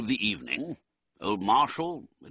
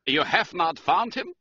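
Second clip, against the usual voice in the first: higher, worried German count; mildly anxious, pitched up; 9 semitones higher, 4 dB louder than usual. A narrator's reading. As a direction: ask anxiously in a German accent.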